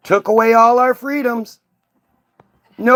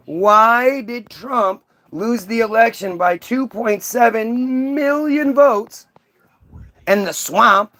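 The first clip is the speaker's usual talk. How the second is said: slowed emphatic question; slower than usual. Real, not acted. Repeating question to trolls, slowed.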